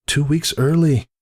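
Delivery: high, anxious nursemaid voice; fretful nursemaid. Acted. Nursemaid frets to Whisper, high and anxious. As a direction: fuss over the early birth. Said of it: "the nursemaid had said".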